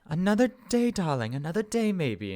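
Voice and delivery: high, lilting, easing down; placating mother. Acted. Mother answering her daughter's pleas, indulgent motherly lilt. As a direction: put off an eager child fondly. Mother soothes excited child.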